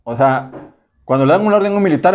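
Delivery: ordinary delivery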